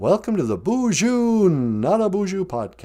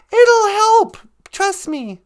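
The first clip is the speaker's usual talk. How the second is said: much higher, louder reassurance; Natasha voice; 14 semitones higher, 4 dB louder, slower than usual. Acted. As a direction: reassure him in the high character voice.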